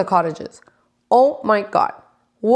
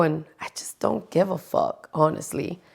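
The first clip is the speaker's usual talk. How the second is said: whisper; 3 semitones lower, 7 dB quieter than usual. Real, not acted. Confiding not caring.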